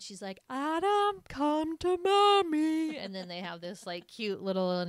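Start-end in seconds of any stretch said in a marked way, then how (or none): 0.5-3.0 high, stretched singsong call; mock mommy call. Playing giant mom calling Adam over, high singsong. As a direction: imitate a mother cooing loudly to her toddler. Voicing mother calling child.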